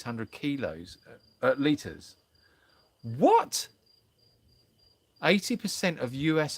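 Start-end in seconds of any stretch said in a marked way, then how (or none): 3.2-3.7 sudden high squeak of disbelief; a real, startled what, pitched way up; baffled what. Real, not acted. Host reacting to a confusing chat comment, startled high what.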